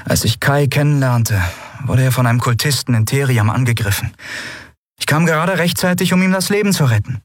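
Endriel explaining. A narrator's reading. Endriel tells Lien about the attack, urgent. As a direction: rush through the story urgently. Narrator voices Endriel, higher.

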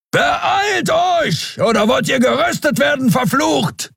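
high, strained bellow to hurry them; thundering shout. Acted. Keru thunders at the dawdling lovers, shouting. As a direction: yell the threat at full force. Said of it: "donnerte Keru".

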